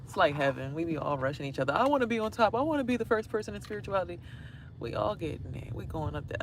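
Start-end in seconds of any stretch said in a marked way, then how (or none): 1.7-4.2 mock eager climber. Mocking people rushing to heaven.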